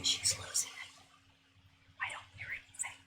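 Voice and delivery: hushed, breathy whisper. Pretend. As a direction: whisper it like a secret aside. Imagined viewers then her reply.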